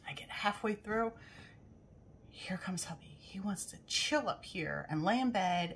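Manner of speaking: hushed whisper as hubby walks in. Real, not acted. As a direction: whisper so he won't hear. Husband comes in while editing.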